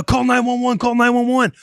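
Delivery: high, urgent retold command; panicked order. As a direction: imitate a frantic parent demanding help now. Mother orders emergency call, retold.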